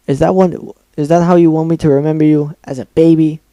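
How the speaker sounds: loud, rising furious rant; angry rant. Acted. Pinkie ranting at Dash.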